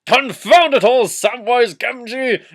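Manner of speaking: cartoon Gandalf outburst. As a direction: bellow it like an exasperated old wizard. Gandalf shouting at Sam.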